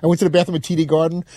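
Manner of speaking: normal talking voice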